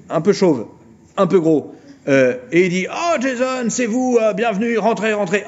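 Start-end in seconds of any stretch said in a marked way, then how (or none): 2.9-5.4 high, fussy welcoming voice; hammed-up friendly father; welcoming old father. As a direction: greet him like a jolly old dad.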